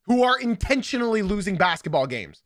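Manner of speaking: ordinary talk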